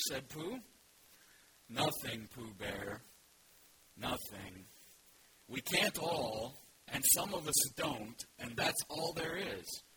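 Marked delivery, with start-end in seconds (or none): 1.7-9.8 very slow, low, gloomy donkey; slow Eeyore. Acted. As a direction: let every word sag with self-pity. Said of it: "you have to read it slowly".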